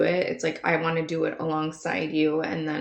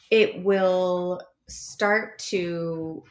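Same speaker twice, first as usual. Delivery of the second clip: slow reading; much slower than usual. Shown as a demonstration. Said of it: "the author changes his voice a little bit".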